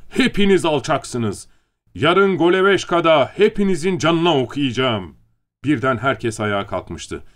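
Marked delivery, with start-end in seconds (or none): 0.1-5.1 raised, high-pitched angry threat; shouting at full voice. A narrator's reading. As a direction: bellow insults at the whole room. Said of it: "avazı çıktığınca bağırıyordu".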